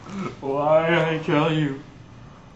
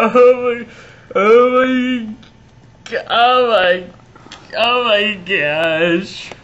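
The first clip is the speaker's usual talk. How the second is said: louder, higher repeated cries; loud disbelief; 4 semitones higher, 7 dB louder, slower than usual. Acted. Nephew reeling from his uncle's betrayal, loud. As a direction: wail it out in shock.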